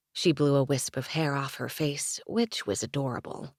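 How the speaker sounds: plain reading voice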